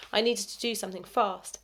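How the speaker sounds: plain reading voice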